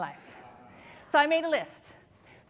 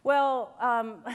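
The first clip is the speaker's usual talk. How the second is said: ordinary delivery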